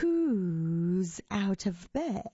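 very slow, eerie monster call; spooky, drawn-out monster question; icy monster voice. A narrator's reading. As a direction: stretch each word like a chilly menace. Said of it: "an icy voice called".

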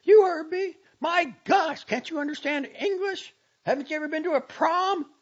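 high, loud exasperated tirade; blazing anger. A narrator's reading. Girl scolding the uncomprehending doctor, blazing with anger. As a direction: fire exasperated questions at him. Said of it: "she blazed forth".

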